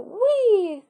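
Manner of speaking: high playful whee; playful wee. A narrator's reading. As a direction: sing out as you swing. Swinging him around.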